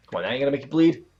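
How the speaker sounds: ordinary talk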